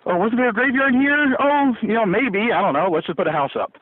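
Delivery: high, offhand careless voice; careless settler voice. Retold or imitated. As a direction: shrug it off like a careless builder.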